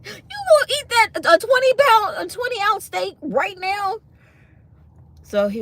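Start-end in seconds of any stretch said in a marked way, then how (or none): 0.0-4.0 incredulous tease. Retold or imitated. Teasing her man over his steak, high incredulous squeal. Repeats tease, laughing disbelief.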